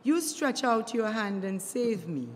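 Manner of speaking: ordinary talk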